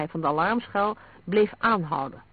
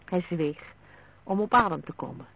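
ordinary delivery

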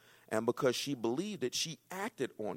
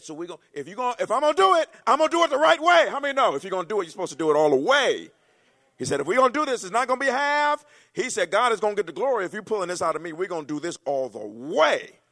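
much higher and louder shouted sermon; full-force preaching; 8 semitones higher, 15 dB louder than usual. Acted. Preacher voicing Jesus's command, shouted at full force. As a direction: shout it to the back row.

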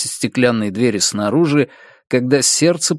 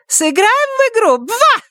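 very high squeaky cartoon catchphrase. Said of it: "одна из крылатых фраз в мультфильме".

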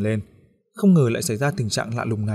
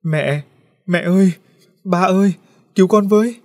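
put-on boy shrieking for help, rising ever higher and louder; terrified scream; 8 semitones higher, 7 dB louder than usual. A narrator's reading. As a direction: scream for mom and dad in terror. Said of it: "Cậu gào lên".

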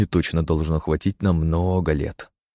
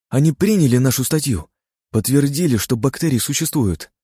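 overjoyed; 7 semitones higher than usual. Acted. Reading the acceptance letter to his brother, overjoyed. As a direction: burst out with the good news.

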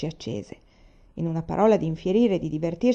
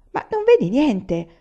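outraged cry; 6 semitones higher, 6 dB louder than usual. A narrator's reading. Aiwan outraged at the torture.

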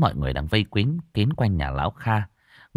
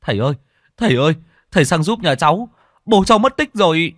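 high, fast, panting alarm, louder at the end; breathless; 8 semitones higher, 6 dB louder, faster than usual. Acted. Son runs in breathless, begging the healer for help. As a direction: gasp it out after running. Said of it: "nói như thể không thở ra hơi".

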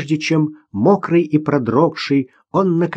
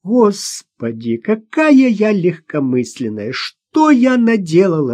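slow, rising wail of self-reproach; lamenting; 6 semitones higher, slower than usual. A narrator's reading. Mother blames herself for the danger, lamenting. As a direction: wail it, stretching the words, climbing higher. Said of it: "снова запричитала Ирина".

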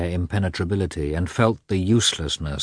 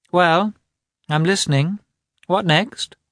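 much higher and louder, lightly taunting; mocking; 11 semitones higher, 3 dB louder than usual. A narrator's reading. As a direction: toss it back with mock patience. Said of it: "said she, quietly and mockingly".

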